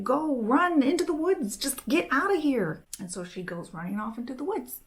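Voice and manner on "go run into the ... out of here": urgent, higher and louder. Acted. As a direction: push her to run for her life.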